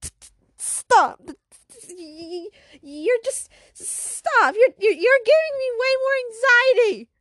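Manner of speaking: stammering, rising to a high, loud plea; stutter, then burst out in panic; acted anxious outburst. Pressured girl begging classmates to stop, anxious outburst.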